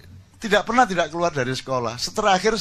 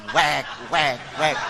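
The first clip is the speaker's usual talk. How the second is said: mock tearing noise; 4 semitones lower, much slower than usual. Tearing the OSIS book, mimicked.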